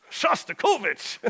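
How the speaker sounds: drawn-out, high, loud Russian-accented mock curse; hammed-up Russian outburst; [name]. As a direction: curse comically like an upset Russian Cossack. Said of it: "that's what I say when I get upset".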